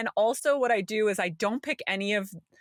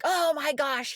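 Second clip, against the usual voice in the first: retold yell; 7 semitones higher, slower than usual. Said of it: "he's yelling".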